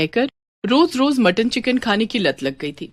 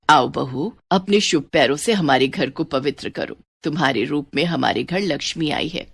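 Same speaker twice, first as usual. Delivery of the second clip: a lower, welcoming mother-in-law voice; mother-in-law welcoming her new daughter-in-law, a touch lower; lightly voiced mother-in-law; 6 semitones lower than usual.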